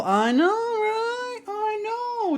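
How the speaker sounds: very high, drawn-out mock lament; mock wail. Streamer lamenting a dead game character with viewers, high mock wail. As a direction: squeal it in exaggerated sympathy.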